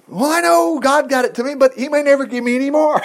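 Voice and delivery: high-pitched comic objection; mock worried protest. Man arguing with his pastor over giving, mock high protest. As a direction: plead it high, hammed up. Voicing a reluctant giver's objection.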